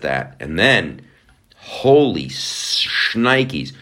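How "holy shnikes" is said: a slow, amazed holy shnikes; drawn-out exclaim. Real, not acted.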